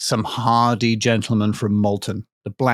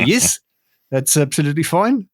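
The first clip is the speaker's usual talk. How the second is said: put-on higher-pitched, botched New Zealand accent slipping towards South African; wobbly mock New Zealand accent; bad New Zealand accent; 4 semitones higher than usual. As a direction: attempt a Kiwi accent and miss. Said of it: "speak with a New Zealand accent".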